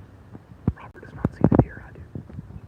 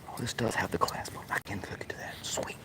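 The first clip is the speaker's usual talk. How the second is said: hushed aside; whispering; 7 dB quieter than usual. Real, not acted. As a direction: whisper while hooking the leash. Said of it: "That's why I'm talking low".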